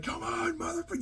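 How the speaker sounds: high, strained yell of a taunt; mock scream. Fan retelling Cody's taunt, yelling high. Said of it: "screaming at him".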